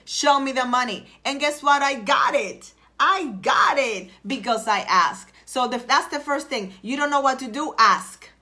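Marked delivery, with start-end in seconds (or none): none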